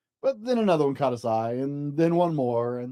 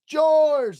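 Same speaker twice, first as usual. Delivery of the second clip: loud high shout of the name; children crying out; 11 semitones higher, 7 dB louder than usual. Acted. Children shouting for George to place the star, loud and eager. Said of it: "the children cried at once".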